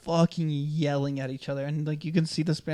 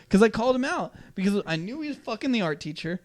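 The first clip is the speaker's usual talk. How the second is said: normal talking voice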